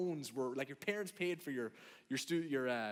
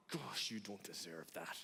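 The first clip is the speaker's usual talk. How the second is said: low, slow grumble under the breath; hushed, drawn-out disapproval; muttered resentment; 9 semitones lower, 9 dB quieter than usual. Acted. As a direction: mutter a grudge, soft and low. Acted private resentment of lucky people.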